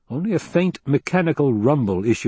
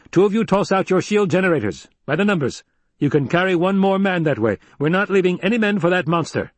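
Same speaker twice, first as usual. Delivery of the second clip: brisk orders, pitched up; commanding Duke; 9 semitones higher than usual. Acted. Duke telling his men to lighten their ships, commanding voice. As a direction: command the escort with resolve.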